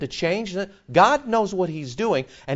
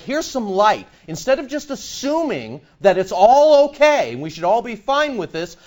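usual speaking voice

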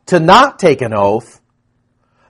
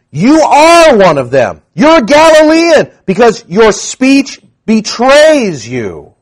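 accusing crowd; 7 semitones higher, 4 dB louder, slower than usual. Acted. Bystanders confront Peter.

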